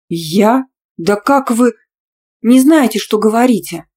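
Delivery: loud, high, affronted outburst; indignant exclamation. A narrator's reading. Student protesting her teacher's slander, indignantly. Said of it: "воскликнула, возмущаясь чистейшей клевете".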